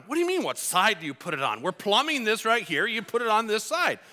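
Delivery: quick, irritated correction, pitch climbing; re-enacted crabby dad. Frustrated dad correcting son on plumbing, irritated. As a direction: snap back at a question that seems stupid. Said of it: "I'm, like, yelling at my kids".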